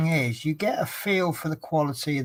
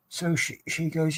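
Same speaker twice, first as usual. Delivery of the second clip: ordinary talk, nothing marked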